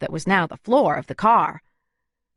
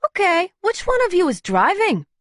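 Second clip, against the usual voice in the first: much higher, brisk woman demanding; Luna's voice; 11 semitones higher than usual. Acted. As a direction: take charge of the crowd. Luna's voice held.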